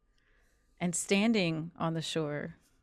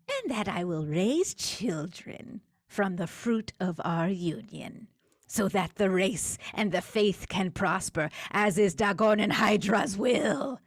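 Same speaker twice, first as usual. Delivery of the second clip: tinny guttural creature dictating; 3 semitones higher than usual. Acted.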